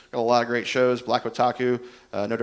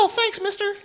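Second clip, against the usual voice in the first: put-on very high-pitched thrilled child voice; excited kid. Kid thanking the man who'll ride along, excited. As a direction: squeak it like a delighted little kid. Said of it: "He got all excited".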